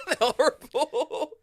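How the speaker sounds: loud, high, drawn-out through laughter; slow it down and laugh it out; gleeful high-pitched indignation; laughing outrage. Real, not acted. Mock outrage at old ladies.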